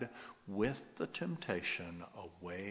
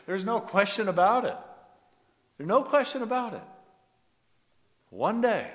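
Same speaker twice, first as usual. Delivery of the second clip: much higher and louder, fast, ringing; full-force preaching; 12 semitones higher, 11 dB louder than usual. Acted. Preacher declaring God's victory, full-force shout. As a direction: shout it out with certainty.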